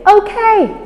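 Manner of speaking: high release okay; release word. Shown as a demonstration. Trainer showing the release cue, high pitched. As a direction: let him go with a high okay. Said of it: "you're gonna say".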